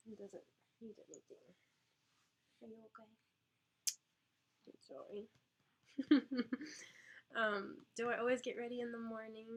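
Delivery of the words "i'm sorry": slow, drawn-out tender apology. Real, not acted. Apologising to crying baby.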